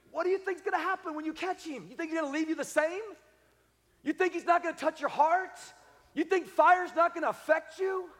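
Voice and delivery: high, mounting rhetorical questions; incredulous challenge. Real, not acted. Preacher challenging congregation about catching God, incredulous.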